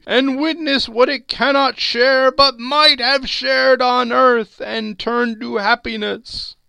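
high, loud, hollow spectre; solemn ghost. Acted. Marley's ghost lecturing Scrooge, high and loud. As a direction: pronounce it like a doom.

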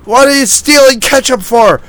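high, loud mock scolding; angry yelling. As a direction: act out a drunk friend blowing up. Drunk Randy yelling at Pete.

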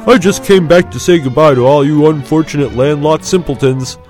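1 teasing. A narrator's reading. Biggs teases his friends.

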